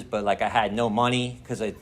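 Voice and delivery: normal talking voice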